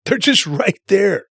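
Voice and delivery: a real laugh breaking into the line. Real, not acted. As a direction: chuckle it out, amused. Laughs about demons nearby.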